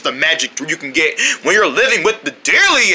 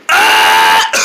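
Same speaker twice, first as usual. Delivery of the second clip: demonstrated scream; 4 semitones higher, 4 dB louder than usual. Shown as a demonstration. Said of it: "This is my scream".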